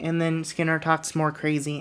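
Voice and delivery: ordinary delivery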